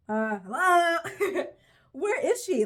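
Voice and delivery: hello, anyone?; incredulous. Real, not acted. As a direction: call out in mock outrage. Outraged search for missing character.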